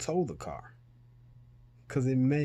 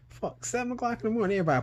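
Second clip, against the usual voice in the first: much higher exasperated outburst; retold frustration; 12 semitones higher, much faster than usual. Son panicking about his missing father, exasperated. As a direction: vent at the early hour. Panicked retelling of early call.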